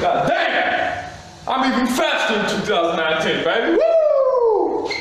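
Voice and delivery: crowing over the win; excited. Real, not acted. Hyped celebration after fight.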